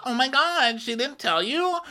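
put-on very high, overdramatic girlish voice; hammed-up high whine of scandal; mock gossiping girl. Friend breaking gossip to Paige, mock drama.

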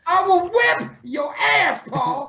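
slow, high-pitched screechy threat; comic high-voiced menace; mock threatening.

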